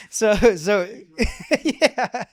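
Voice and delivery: high, loud words breaking through laughter; laughing hard. Real, not acted. Guest laughing over the methane debate, cracking up. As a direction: try to resume while cracking up.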